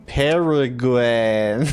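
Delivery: Peregrine stretched slowly, syllable by syllable; drawn-out Peregrine. Shown as a demonstration. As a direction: drag out Peregrine as if unsure. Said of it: "I just end up saying".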